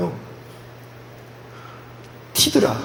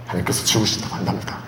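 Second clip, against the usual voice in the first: whispered aside; 8 semitones lower, 7 dB quieter, faster than usual. Acted. Whispered aside about God's verdict.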